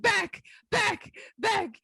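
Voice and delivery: high, excited calls to a friend; high-pitched repeated call; imitated urgent calling. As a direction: mimic eagerly getting a friend's attention. Retold calling a friend over urgently.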